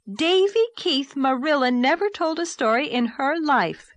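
high, loud indignant outburst, slowed on the name. A narrator's reading. Anne defending Marilla to Davy, high loud indignant. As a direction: snap his name out in shocked protest. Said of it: "said Anne indignantly".

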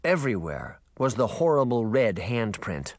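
ordinary delivery, nothing marked

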